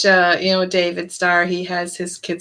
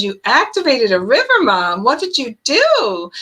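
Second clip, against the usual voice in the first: incredulous son; 6 semitones higher than usual. Retold or imitated. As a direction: act the son who can't believe it. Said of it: "And he goes".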